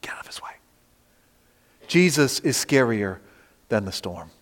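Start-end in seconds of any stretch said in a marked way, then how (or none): 0.0-0.5 a frightened unvoiced whisper. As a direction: hiss it like the waves ducking aside. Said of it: "They're like".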